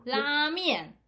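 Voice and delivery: 拉面 said as Japanese rāmen, high and loud; 拉面 in Japanese, long first vowel; Japanese ramen. Shown as a demonstration. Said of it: "我们来讲日文啦". (日语发音的拉面，高声示范)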